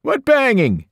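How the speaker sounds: indignant outside shout; yelling. A narrator's reading. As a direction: holler back baffled. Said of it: "Eddie yelled".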